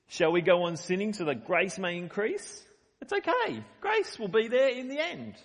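lightly voiced smug sinner, rising high on it's okay; mocking the excuse. Preacher mimicking a smug sinner's excuse, lightly mocking. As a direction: shrug it off like a cheeky excuse-maker. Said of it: "So he says".

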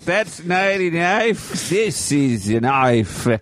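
slow, badly faked Australian accent. Quoting Crocodile Dundee to friends, slow bad Australian accent. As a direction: drag out a terrible Crocodile Dundee impression. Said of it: "That was the worst accent".

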